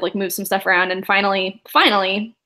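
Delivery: ordinary talk, nothing marked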